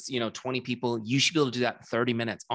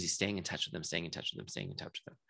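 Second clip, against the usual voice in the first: fading echo; 6 semitones lower, 8 dB quieter than usual. Shown as a demonstration. Repeating the phrase, fading away.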